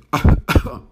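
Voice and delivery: a sharp, loud demonstration cough; demo cough. Shown as a demonstration. Teacher showing learners what toser means, with a loud cough. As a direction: cough once, hard, to show the word. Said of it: "¿Qué significa toser?".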